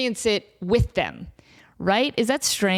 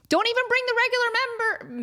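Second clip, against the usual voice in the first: slips and says member instead of menu; tongue slip; 10 semitones higher than usual. Real, not acted. Telling friends the dessert plan, slipping on the word. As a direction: trips over the word member. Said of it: "That's what I meant to say".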